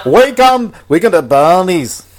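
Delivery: loud, high-pitched hammy Scottish accent. Shown as a demonstration. Podcaster showing off a film title to co-host, Scottish accent. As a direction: belt it out in a put-on Scottish accent. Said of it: "That was my Scottish accent".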